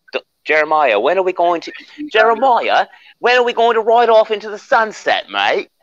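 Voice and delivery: high, loud hammed-up Australian accent. Boss inviting Jeremiah on a ride, Australian accent. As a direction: play the boss as a cheery Aussie mate. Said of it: "that sounded bloody Australian".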